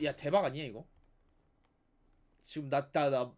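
ordinary talk

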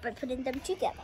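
ordinary delivery

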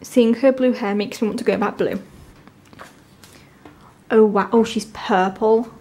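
usual speaking voice

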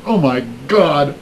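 drawn-out, mock-horrified cry; horrified outcry. Mourners seeing the casket attacked, mock horror. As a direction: imitate a horrified crowd, stretching the words. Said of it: "everybody was horrified".

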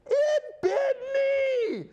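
चीख कर, बहुत ऊँची आवाज़ में धीरे-धीरे; दर्द से चिल्लाते हुए ज़ोर से और ऊँचा; retold scream. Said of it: "And he screams loudly".

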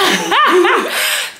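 loud high burst of laughter. Real, not acted. Laughing at sister's money joke.

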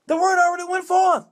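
high, sustained churchy proclamation; proclaiming prophecy. Acted. Church member telling the pastor of a word, proclaiming.

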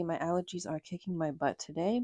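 ordinary delivery

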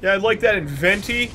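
high, prissy ordering voice; mock coffee order. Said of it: "can you say it with more of a lisp".

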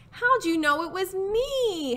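high, drawn-out turkey howl. Acted. Disguised Turkey found out by Rooster, plaintive howl. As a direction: howl it slowly, stretching up high. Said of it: "howled Turkey".

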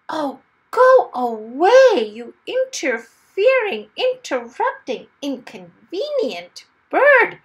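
drawn-out cry of exasperation; exasperated shout. Acted. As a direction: shoo the pest away with a half-laughing roar. Wumble shoos the parrot.

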